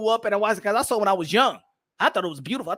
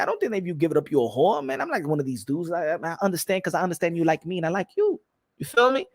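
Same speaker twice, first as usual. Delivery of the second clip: normal talking voice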